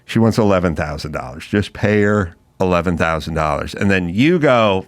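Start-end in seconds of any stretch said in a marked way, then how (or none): 0.0-3.7 low, level voice of reason; sensible advice. Acted. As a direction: talk them down in a low, measured tone. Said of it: "sensible people around you go".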